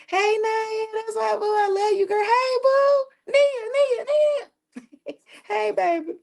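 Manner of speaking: high, sing-song hello to viewers; gushing high-pitched shout-out; high greeting. Real, not acted. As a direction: call out to fans way up high.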